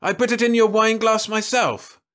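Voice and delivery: disbelieving protest. Acted. Jim protesting to Louise in disbelief. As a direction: insist in disbelief.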